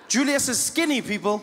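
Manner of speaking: normal talking voice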